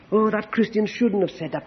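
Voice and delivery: ordinary talk, nothing marked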